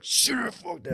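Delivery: high, harsh command; stern yell. Retold or imitated. Parent freezing the kids with a high, harsh command. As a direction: freeze them with a sharp order. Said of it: "If you're like".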